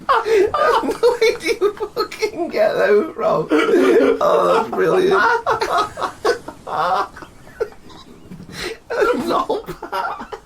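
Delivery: slow, high, laughing in disbelief. Real, not acted.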